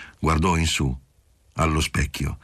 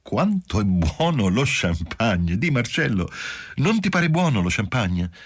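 tipsy exclaiming; 5 semitones higher than usual. A narrator's reading. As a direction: rave about the champagne. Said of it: "Improvvisamente esclamò".

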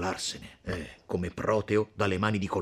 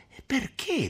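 higher sharp "E perché?"; both blurt it at once; sudden question. Acted. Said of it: "domandarono a un tratto i due giovani". ("E perché?" di colpo, acuto)

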